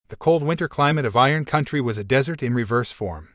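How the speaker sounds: usual speaking voice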